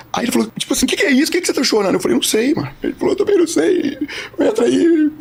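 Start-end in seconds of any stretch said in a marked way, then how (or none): 0.9-1.9 high, imitated weepy questioning; tearful. Crying clerk asking the man why he cries, tearful. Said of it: "o cara começou a chorar". 2.1-2.7 high-pitched imitated crying reply; tearful. Crying man answering the clerk, tearful. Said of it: "eu chorando". 3.1-5.1 very high imitated weepy voice; tearful. Crying clerk letting the man in, tearful. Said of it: "Chorando, chorando, os dois chorando".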